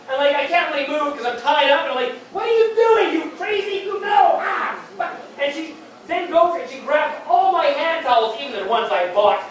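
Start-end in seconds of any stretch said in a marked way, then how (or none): 2.3-4.8 high, loud comic outrage; retold alarmed shouting. Tied-up man to his attacker, hammed-up alarmed shouting. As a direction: shout in mock pain and alarm.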